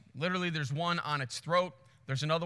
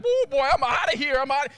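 loud, higher comic lion; fleeing lion; 6 semitones higher, 6 dB louder than usual. Retold or imitated. Lion bailing out on the fight, loud comic panic. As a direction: play a spooked lion, loud and high. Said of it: "that lion's like".

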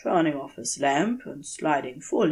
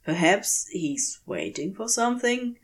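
plain reading voice

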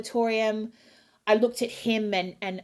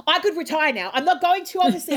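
raised, loud, giddy declaration; elated; 7 semitones higher, 4 dB louder than usual. Retold or imitated. Singer telling husband after the show, elated. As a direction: announce it giddily, on the edge of laughing.

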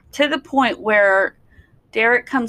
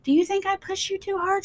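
higher, softer caring question; gentle question; 9 semitones higher, 4 dB quieter than usual. Retold or imitated. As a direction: ask it gently, voice lifted high. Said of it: "I said".

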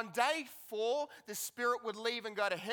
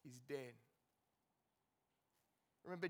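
ordinary delivery

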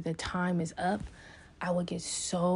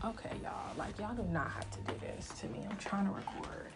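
much softer, hushed whisper; whispered aside; 10 dB quieter than usual. Real, not acted. Hushing the people interrupting her recording, whispered. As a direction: whisper it so the mic barely catches it.

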